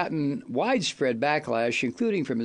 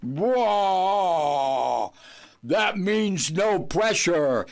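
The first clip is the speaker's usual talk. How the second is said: mock bluster; much slower than usual. Host parodying Trump pressuring Ukraine, mock bluster. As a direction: boom it out like a blowhard.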